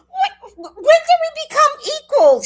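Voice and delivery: very high, loud, laughing cry; laughing outrage. Real, not acted. Grandma laughing at grandson's nerve, high loud laugh. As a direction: shriek it through laughter.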